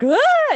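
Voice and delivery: shrieking scream. Retold or imitated. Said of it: "she's like".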